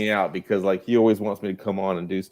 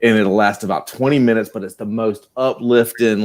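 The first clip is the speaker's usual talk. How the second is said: normal talking voice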